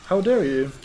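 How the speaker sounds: mock outrage. Player scolds the departing troll, hammed-up indignant. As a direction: play offended that the troll left.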